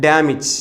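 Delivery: ഡാമേജ് shortened to "dam-ich"; correct pronunciation. Shown as a demonstration. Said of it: "എന്താണ്?".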